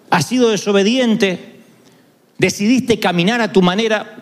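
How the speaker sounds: ordinary delivery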